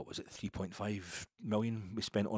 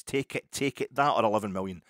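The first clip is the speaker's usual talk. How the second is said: mock urging; 3 semitones higher, 11 dB louder than usual. Podcaster urging the club to take a joke bid, loud mock insistence. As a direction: push the offer loudly, voice jumping up.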